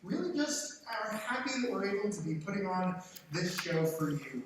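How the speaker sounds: ordinary delivery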